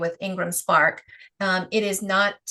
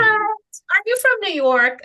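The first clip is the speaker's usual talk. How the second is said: much higher and louder, mock disbelief; baffled question; 12 semitones higher, 6 dB louder than usual. Stranger baffled by her Rhode Island accent, imitated with high disbelief. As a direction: play the confused stranger hearing the accent.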